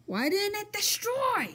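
high, loud frustrated cry; frustrated shout. Real, not acted. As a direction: shout at the game in disbelief. Game failing to destroy.

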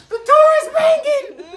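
put-on high, loud, squealing little kid voice; mimicking a scared kid. Dad mimicking his scared kid yelling about the door. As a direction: squeal it like a frightened child. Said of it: "All I hear is".

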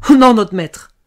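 very high, loud, pleading denial; crying out. A narrator's reading. Servant girl denying it to her master, crying out. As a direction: wail the protest.

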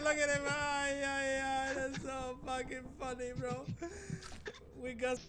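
ordinary delivery

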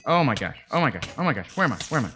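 loud, rapid-fire repeated exclamations; panicked. Real, not acted. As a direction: blurt it out in a panic. Panics in danger in game.

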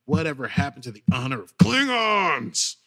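mock grandiose Klingon, slow and stretched out; hammy Klingon captain. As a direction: ham it up like an offended warrior. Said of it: "and he's like".

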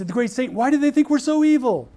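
high, loud mock-outraged question; mock indignation. Americans mocking the Islamic world's view, high mock outrage. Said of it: "we look at that and we say".

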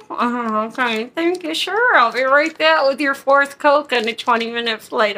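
fake-cheerful and biting; sarcastic. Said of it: "I'll just be like".

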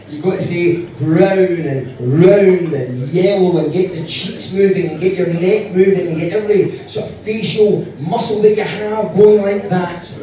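"brown" "round" "yellow" stretched slow and loud with mouth wide open; exaggerated open vowels. Shown as a demonstration. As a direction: chew through "brown and round and yellow" with every facial muscle. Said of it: "use all your muscles".

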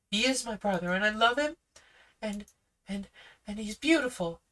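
put-on high, fast, eager girl's voice; a confident girl speaking up; young girl Judy. Judy defends her brother to the policeman, high and eager. Judy's lines.

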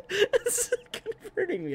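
slow, high, laughing. Real, not acted. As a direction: stretch it out through laughter.

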